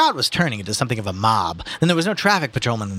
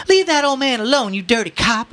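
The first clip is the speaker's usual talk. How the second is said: high, loud angry shriek; screamed; 14 semitones higher, 5 dB louder than usual. Acted. Woman in the crowd defending the old man, screaming. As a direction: scream at the officer in outrage. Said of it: "a woman screamed".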